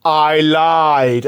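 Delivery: drawn-out put-on punchline; film hero. Hero answering Sully before dropping him, put-on voice. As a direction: deliver it as the hero's kiss-off. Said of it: "he's like".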